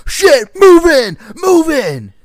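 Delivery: high, loud, slow panicked yell; panicked shout. Retold or imitated. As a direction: bark the order in alarm. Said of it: "They're like".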